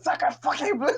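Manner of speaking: high-pitched mock French outburst; hammed-up, squealed French swearing; imitated scream. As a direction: mimic a friend screaming a French curse. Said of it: "just screamed".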